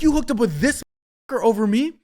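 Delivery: high, loud, indignant retold outburst; outraged disbelief. Confronting the girlfriend who cheated with the guy she hated, outraged. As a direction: throw the question at her in disbelief.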